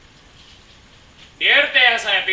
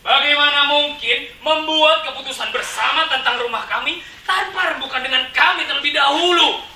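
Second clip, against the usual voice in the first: high, raised indignant question; astonished protest; 6 semitones higher, 4 dB louder than usual. Acted. Residents shocked they were never consulted, indignant protest. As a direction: demand an answer in growing disbelief. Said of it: "Warga kami makin tercengang saja".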